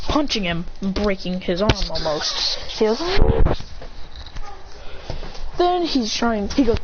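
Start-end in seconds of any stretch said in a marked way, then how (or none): 3.0-5.5 high cry trailing into breath; mock straining cry. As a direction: act out a high, breath-heavy fight cry. Fighter cries out mid-attack.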